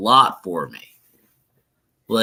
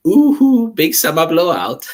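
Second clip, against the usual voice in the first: high, loud singsong Swedish "ooh"; hammed-up Swedish shopkeeper from a cartoon; Swedish accent impression; 8 semitones higher, 6 dB louder than usual. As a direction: call it out in a mock Swedish accent. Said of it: "sounds like that guy from Sweden".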